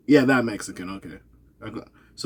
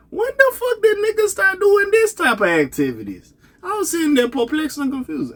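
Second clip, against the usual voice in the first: much higher, exasperated disbelief; outraged disbelief. Real, not acted. Vlogger ranting at friends over new slang, high outraged disbelief. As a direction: squeal it out like you can't believe it.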